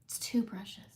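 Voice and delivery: a quick hushed aside; whispered aside. Real, not acted. Host whispers a brush detail to viewers, hushed. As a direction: whisper it, like a little secret.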